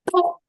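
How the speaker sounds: short, high popped noise; imitated pop. Drummer imitates the cymbal hitting flush, imitated pop. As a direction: imitate a dead metal hit with no ring. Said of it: "So it went".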